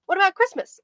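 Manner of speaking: put-on high child's question; young boy. A narrator's reading. Boy asks detective as he leaves, young boy. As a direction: pipe up in a high kid voice.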